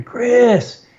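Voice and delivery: high, loud cry of Chris!; retold scream. Wife screaming for her husband from the kitchen, alarmed. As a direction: yell his name in alarm from the other room. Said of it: "I hear my wife scream".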